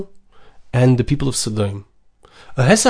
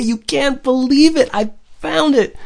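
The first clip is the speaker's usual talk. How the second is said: amazed exclamation; 10 semitones higher than usual. Acted. Avram exclaims finding his treasure.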